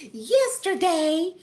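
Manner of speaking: slow, high, sad; sad snake. Acted. Snake Wanda begins her sad tale, slowly. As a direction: drag it out mournfully. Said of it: "said the snake sadly".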